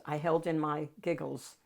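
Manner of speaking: ordinary delivery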